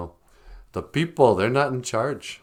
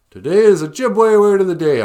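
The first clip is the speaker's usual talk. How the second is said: announcer voice; 9 semitones higher, 9 dB louder than usual. Pretend. Announcing the word.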